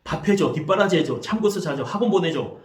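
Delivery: put-on exasperated parent lecturing a tired kid; aggressive scolding parent. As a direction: nag the child as if study were easy. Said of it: "이렇게 공격적으로 말하는 경우가 많습니다".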